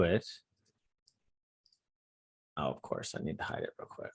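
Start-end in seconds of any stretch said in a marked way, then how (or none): 2.6-4.1 muttering to self. Real, not acted. Muttering to himself while fixing.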